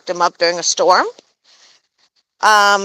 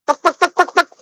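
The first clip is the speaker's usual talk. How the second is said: high clucking buck buck sounds; chicken clucking imitation; 9 semitones higher, faster than usual. Showing the other chickens, clucking high. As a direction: imitate the hens clucking, pitched up. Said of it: "If you listen to all the other chickens".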